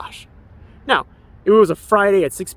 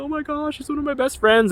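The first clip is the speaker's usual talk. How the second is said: surprised delight; 10 semitones higher than usual. Retold or imitated. Recalls seeing friend's tweet.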